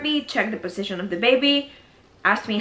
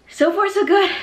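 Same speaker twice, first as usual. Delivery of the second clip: excited; 11 semitones higher, 4 dB louder than usual. Real, not acted. Happy update on pregnancy.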